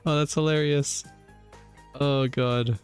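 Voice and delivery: ordinary talk, nothing marked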